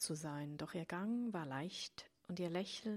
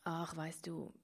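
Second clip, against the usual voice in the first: hesitant. A narrator's reading. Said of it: "begann sie zögernd und äußerst erstaunt".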